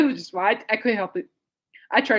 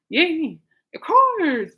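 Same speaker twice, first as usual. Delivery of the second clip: high, loud sarcastic hooray; mock cheer; 9 semitones higher, 6 dB louder, much slower than usual. Reacting to health issues threatening concert, mock cheer. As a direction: cheer far too brightly at bad news.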